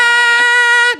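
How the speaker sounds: high, loud sustained scream; top-of-lungs scream. Retold or imitated. Retelling himself running around the house, full scream. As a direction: scream like a terrified kid. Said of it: "I'm screaming at the top of my mo-, lungs".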